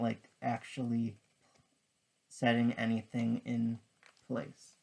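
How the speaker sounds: ordinary talk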